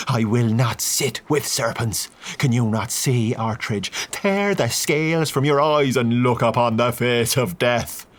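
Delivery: slow, preaching denunciation; a zealot warning of death; fervent priest, accusing; priest Vickers, fervent. A narrator's reading. Priest denounces them.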